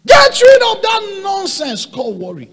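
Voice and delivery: high, slow, loud shout; preaching shout. Real, not acted. Preacher ordering the congregation to drop worry, shouting. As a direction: command them to throw worry away.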